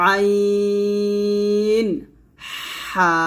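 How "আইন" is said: আইন drawn out with pressure from mid-throat; pressed throat sound. Shown as a demonstration. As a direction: squeeze আইন from the throat, slowly. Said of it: "এভাবে". (গলার মাঝখানে চাপ দিয়ে আইন)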